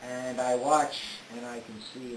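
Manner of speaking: normal talking voice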